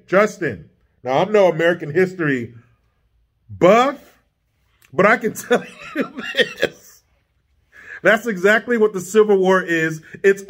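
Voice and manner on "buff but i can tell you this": laughing. Real, not acted. Laughs mid-retort to Trudeau.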